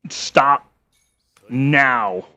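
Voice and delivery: slow, commanding decree; stern command. Pretend. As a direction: order it like a king. Commanding the game character.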